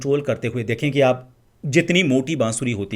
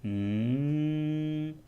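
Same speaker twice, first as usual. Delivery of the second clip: long soft mmm pitched higher; high m hum; 4 semitones higher, 7 dB quieter, much slower than usual. Shown as a demonstration. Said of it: "दूसरे में आपको म ही करना है". (ऊँची, हल्की, लंबी म ध्वनि)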